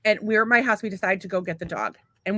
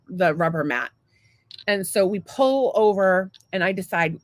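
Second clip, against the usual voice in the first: normal talking voice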